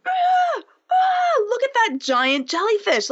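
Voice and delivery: very high, thrilled squeal at the jellyfish; excited squeal. Retold or imitated. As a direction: gasp with delight at a sea creature. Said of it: "I'm like".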